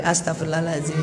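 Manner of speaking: low, soft, comforting murmur; soothing istighfar. Acted. Friend stroking a crying widow, saying istighfar for her, soothing. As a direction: hush a crying friend with a quiet prayer. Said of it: "Sambil belai-belai".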